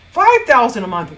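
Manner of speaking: loud, punched announcement; thrilled shout; escalating excitement. Acted. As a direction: belt out the milestone. Excited escalating income milestones.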